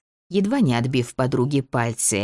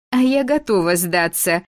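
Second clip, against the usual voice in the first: хихикая; 6 semitones higher, 3 dB louder than usual. Acted. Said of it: "хихикнула".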